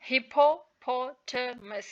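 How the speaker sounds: "hippopotamus" broken slowly into separate syllables; teacher slowly spelling out the sounds of "hippopotamus". Shown as a demonstration. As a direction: sound out "hippopotamus" part by part, pausing between syllables. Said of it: "with their correct pronunciation".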